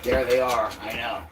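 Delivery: high, soft questions to the dog; doggy talk. Real, not acted. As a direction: ask the dog sweetly what she sees. Asks dog what she sees.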